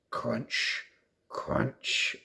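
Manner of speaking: soft breathy crunch sounds; crisp it like footsteps in snow; mock crunching. A narrator's reading. Reader voicing Lucy's steps in snow, breathy crunch.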